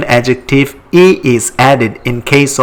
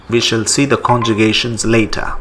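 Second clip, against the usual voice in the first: usual speaking voice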